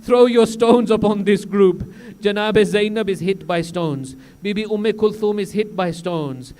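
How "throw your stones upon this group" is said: commanding call. Acted. Crier orders stoning the captives.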